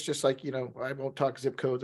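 ordinary talk

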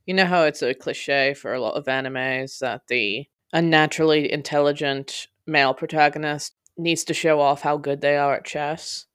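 ordinary delivery, nothing marked

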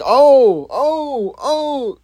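repeated high, rising-and-falling "oh"s; fight-fan reaction. Acted. Fan reacting to a wild fight, excited high-pitched ohs. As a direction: ooh and oh like a fan watching the action. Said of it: "you're gonna be like".

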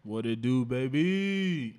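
high, loud playful greeting; Kawhi voice. Pretend. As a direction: greet them like Kawhi Leonard. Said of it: "In my Kawhi voice".

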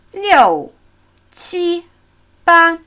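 "Liu" falling loudly, "qi, ba" held high and level; falling then high tones. Shown as a demonstration. Teacher counting in Chinese by tone pattern, falling then high tones. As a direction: drop hard on "Liu", then keep "qi, ba" high and even. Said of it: "Also fallende Ton, zwei hohe".

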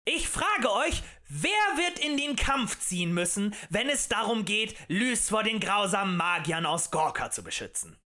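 high, loud orator's pitch; impassioned tavern agitator; rabble-rousing harangue. A narrator's reading. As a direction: stir up a crowd with indignation.

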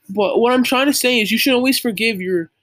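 ordinary delivery

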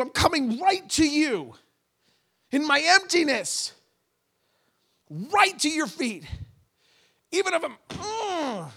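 anguished prayer. Pretend.

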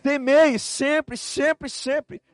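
very slow, high and loud; drawn-out preaching cry. Acted. Pastor exhorting the church, very slow and loud. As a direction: stretch each word out.

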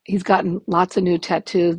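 usual speaking voice

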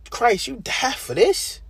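high incredulous cry to Christ; astonished question. Retold or imitated. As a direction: plead in stunned wonder. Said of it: "I'd be like".

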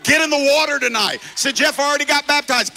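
loud, high, shouted preaching; preaching at full force. Real, not acted. Preacher shouting to the congregation. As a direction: preach it hard to the crowd.

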